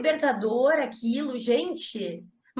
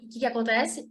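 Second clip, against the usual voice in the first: normal talking voice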